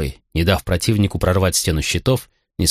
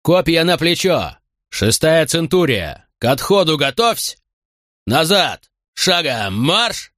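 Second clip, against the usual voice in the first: shouted orders; 8 semitones higher, slower than usual. A narrator's reading. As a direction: bellow the commands, high and drawn out. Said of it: "во весь голос стал отдавать приказы".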